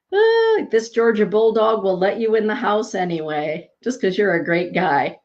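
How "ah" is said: high laugh. Real, not acted. Laughing at viewer banter.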